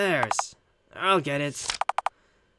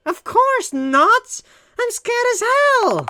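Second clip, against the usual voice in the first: much higher, louder, slow panicked cry; scared wail; 7 dB louder, much slower than usual. Acted. Frightened girl answering if she is okay, high panicked wail. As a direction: shriek it out in fright.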